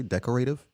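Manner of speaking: decorative said as DEC-o-ray-tive, questioning; decorate-style pronunciation. Shown as a demonstration. Host checking a word with viewers, decorative said DEC-o-ray-tive. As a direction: stress DEC, full "rate" inside decorative. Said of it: "How do you say that".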